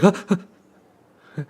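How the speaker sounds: startled gasps. Acted. Riolus flinching.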